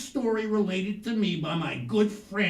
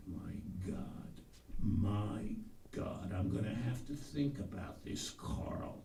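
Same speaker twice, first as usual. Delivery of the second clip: dismayed murmur; 8 semitones lower, 8 dB quieter than usual. Retold or imitated. Pep is shaken by the news.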